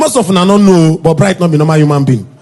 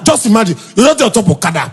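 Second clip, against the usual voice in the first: ordinary talk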